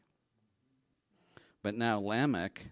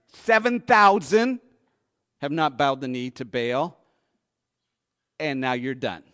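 God's firm answer; 11 dB louder than usual. Retold or imitated. God answering Elijah.

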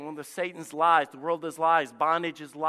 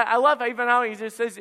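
usual speaking voice

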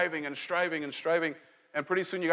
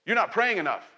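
higher, louder, accusing tone on not; accusing condemnation voice; 6 semitones higher, 4 dB louder than usual. Retold or imitated. Pastor voicing condemnation to the church, higher and louder accusing. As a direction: scold them like a nagging inner voice. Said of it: "The condemnation tells you this".

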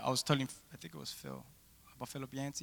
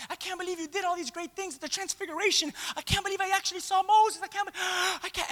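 higher, breathless awe; excited gushing; 10 semitones higher than usual. Retold or imitated. Disciples gushing to Jesus about the miracle, high and thrilled. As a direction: gush with amazement.

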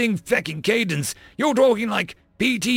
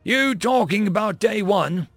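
taken aback; 10 semitones higher, 4 dB louder than usual. A narrator's reading.

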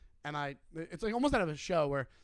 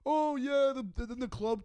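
higher, louder heckler voice; mock heckler; 7 semitones higher, 4 dB louder than usual. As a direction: pitch up and push for the heckler. Heckler voiced in retold story.

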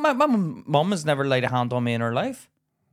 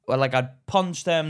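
ordinary talk, nothing marked

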